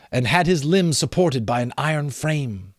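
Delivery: ordinary delivery, nothing marked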